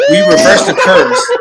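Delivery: laughing, high-pitched cheer; excited. Real, not acted. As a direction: crow it out with a laugh. Big win celebration.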